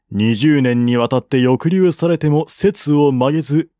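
usual speaking voice

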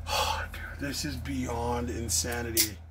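sighing whisper, low and hushed; awed whisper. Real, not acted. Telling viewers about the knife in a sighing whisper. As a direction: breathe out your disbelief.

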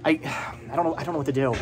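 normal talking voice

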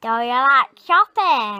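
loud northern English twang on shopping; northern accent. Shown as a demonstration. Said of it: "That's northern".

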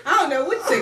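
ordinary delivery

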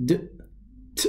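bare d sound, then bare t sound; isolated consonant sounds. Shown as a demonstration. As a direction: give "d-" and "t" as lone consonants. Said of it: "E D is pronounced".

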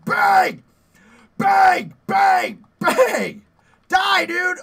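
loud, high shouts of bang as he fires; shouted bang bang. Real, not acted. Gamer firing at a monster, shouting bangs. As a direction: yell each bang like gunfire.

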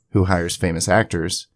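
usual speaking voice

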